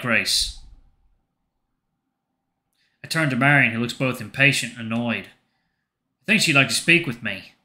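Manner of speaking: ordinary talk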